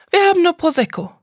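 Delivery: viel höher, lauter und schnell; flinke, hohe Kellnerinnenstimme; Bedienung am Bistro. A narrator's reading. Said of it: "sagte die Bedienung". (Bedienung antwortet der Kundin hoch und schnell)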